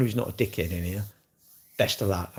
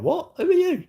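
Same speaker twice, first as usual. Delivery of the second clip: high squeaky pretend bafflement, much higher; mock confused surprise; 4 dB louder than usual. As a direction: act like you've never met him, pitch shooting up. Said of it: "I'll be like".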